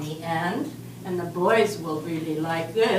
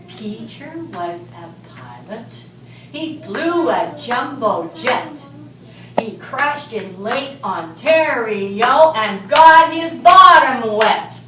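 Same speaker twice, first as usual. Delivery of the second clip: slow, building rhyme that gets louder toward the punchline; storytelling to children; 9 dB louder than usual. Acted. Teacher tells the class a silly rhyme, building playful storytelling. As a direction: read it like a playful nursery rhyme.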